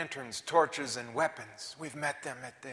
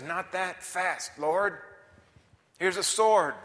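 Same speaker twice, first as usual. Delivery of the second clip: eager, louder Peter; Peter, impulsive; 5 semitones higher, 11 dB louder, slower than usual. Acted. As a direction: jump in boldly as Peter. Peter's eager voice in retold scene.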